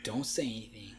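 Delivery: whispered plea, very soft; hushed acted-out warning to a cousin; lip-biting whisper. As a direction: breathe it through a bitten lip. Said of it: "I'm biting my lip like".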